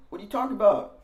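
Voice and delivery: put-on baffled teen. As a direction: act honestly confused and a bit defensive.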